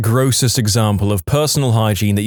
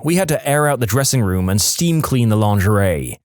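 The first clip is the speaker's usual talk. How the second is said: plain reading voice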